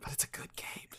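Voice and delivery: very soft, voiceless whisper; whispered aside. Real, not acted. Host gushing about a favourite cape, in a whisper. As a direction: slip it in under your breath.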